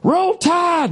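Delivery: high mock stadium chant; imitating screaming football fans. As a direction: yell it like rowdy college fans. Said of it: "and screaming".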